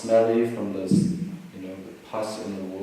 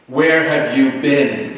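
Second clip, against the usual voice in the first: loud, drawn-out, higher-pitched demand; exasperated mock shout; 5 semitones higher, 13 dB louder, much slower than usual. Shocked hermit to the Buddha who finally appears, exasperated shout. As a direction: cry it out slowly in acted indignation.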